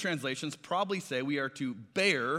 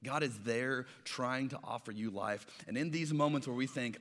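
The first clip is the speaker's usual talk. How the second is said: usual speaking voice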